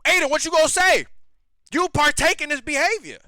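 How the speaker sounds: high, loud, accusing call-out; loud challenge. Real, not acted. Confronting Aiden directly.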